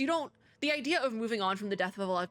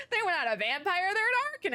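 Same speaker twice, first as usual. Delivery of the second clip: high, laughing through the words; 12 semitones higher than usual. Real, not acted. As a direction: giggle out the punchline. Laughing at the lore twist.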